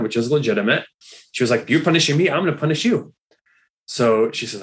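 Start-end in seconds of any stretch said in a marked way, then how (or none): 1.7-3.1 mock angry. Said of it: "she was, like, mad at God".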